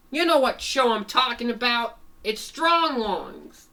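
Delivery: high, loud cartoon retort; exasperated shout. Fango telling the animals the show, exasperated shout. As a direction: belt it out, stretching the show's name.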